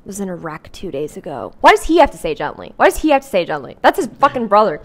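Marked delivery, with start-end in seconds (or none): 1.6-4.8 louder, protesting aside; exasperated objection. Real, not acted. Reader objecting to the book's wording, exasperated. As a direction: break off reading to object loudly. Irritated outburst at the book.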